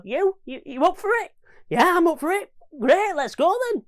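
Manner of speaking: high, loud mock chat-up; mock pickup chat. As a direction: play both sides of a quick pickup. Acted pub pickup exchange.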